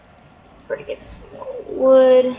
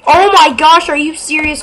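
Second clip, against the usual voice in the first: loud, higher exasperated cry; frustrated outburst; 4 semitones higher, 10 dB louder than usual. Real, not acted. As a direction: blurt it out in real disbelief. Coal blocked, frustrated outburst.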